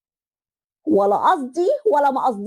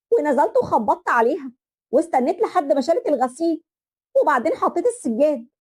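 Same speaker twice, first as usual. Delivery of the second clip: usual speaking voice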